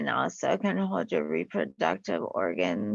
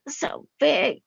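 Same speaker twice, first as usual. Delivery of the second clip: big-breath emphasis; 8 semitones higher, 5 dB louder than usual. Shown as a demonstration. Models a big full breath.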